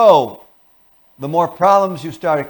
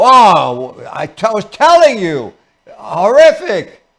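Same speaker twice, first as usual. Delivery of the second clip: louder, higher outburst, slow on the last word; angry spouse; 4 semitones higher, 8 dB louder, slower than usual. Acted. Angry partner complaining about the day, loud. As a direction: vent the awful day at full volume.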